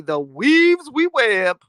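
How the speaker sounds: slow, drawn-out theatrical sigh over weaves; hammed-up dramatic lament; mock lament. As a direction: intone it like a tragic poem.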